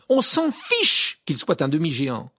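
loud, indignant outburst; angry cry. A narrator's reading. Harry defends Hagrid to Rita, crying out angrily. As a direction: shout it down indignantly. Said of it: "s'écria Harry".

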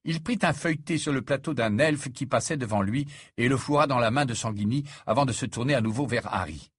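ordinary delivery

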